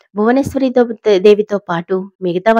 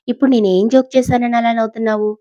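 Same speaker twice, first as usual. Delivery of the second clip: angry; 4 semitones higher, 4 dB louder than usual. A narrator's reading. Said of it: "అని కోపంగా అడిగింది".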